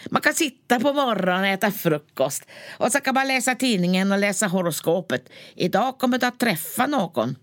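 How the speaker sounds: lightly voiced northern nurse, chatty; a hint of a rural nurse reading her horoscope; Tornedalen nurse. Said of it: "hon brukar säga".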